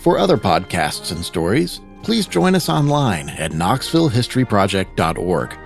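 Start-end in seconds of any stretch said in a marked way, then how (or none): none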